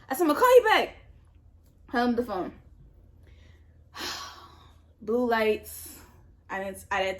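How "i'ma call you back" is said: rushed alarm. Retold or imitated. Hurrying off phone, police behind.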